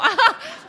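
high, loud burst of laughter. Real, not acted. Preacher cracking up at her own story, loud laugh. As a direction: let the laugh explode out.

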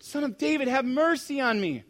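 high, loud plea; desperate shout. Acted. Desperate blind man begging Jesus for mercy, shouting. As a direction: cry out desperately, stretching the plea. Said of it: "This guy is desperate".

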